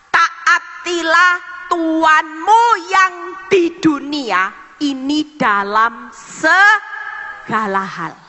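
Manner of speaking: slow, emphatic scripture proclamation; scripture reading. Real, not acted. Preacher reading Kolose to the congregation, slow proclamation. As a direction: proclaim the verse word by word.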